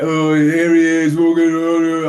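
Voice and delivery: slow, high, loud sing-song taunt; mocking terrace sing-song; mock crowd jeer. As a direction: drag it out like a jeering crowd.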